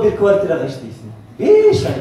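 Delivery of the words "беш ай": high, loud laughing cry of disbelief; excited laughing shout. Real, not acted. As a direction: blurt it out with a laugh. Exclaims, laughing, about hard work.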